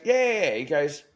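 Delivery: high excited quote of the tradie; quoted bloke. Retold or imitated. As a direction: pitch up for the pest bloke. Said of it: "And he goes".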